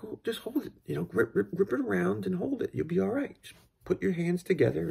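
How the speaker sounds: frustrated dad. Retold or imitated. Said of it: "And I got frustrated".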